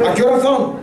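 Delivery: mock-annoyed retold question. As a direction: ask it curtly, as if bothered.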